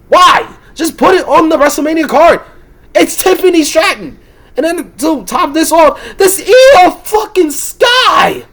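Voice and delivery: high, loud exasperated shouting, half-laughing; yelling up high, laughing in despair; furious rant. Real, not acted. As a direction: scream it out in disbelief at the booking. Peak of rant, shouting, crying laugh.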